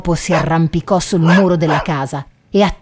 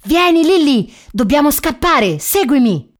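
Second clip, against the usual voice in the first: raised-pitch urging to run; urgent call; 6 semitones higher than usual. A narrator's reading. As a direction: call your friend to flee, pitched up. Vagabond urges escape from farmer. (richiamo urgente, voce più acuta)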